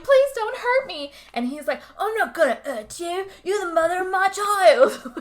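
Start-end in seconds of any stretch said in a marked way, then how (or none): 0.0-1.1 high, loud imitated begging; hammed-up fearful begging; mock frightened plea. Retold mom pleads with attacker. 2.0-5.1 high, hammed-up British villain; exaggerated British accent, growing louder; bad Cockney accent. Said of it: "super bad Cockney".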